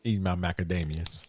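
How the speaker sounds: talking through a mouthful; mouth full. Real, not acted. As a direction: speak while chewing nuts. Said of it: "you mumbling like Grendel".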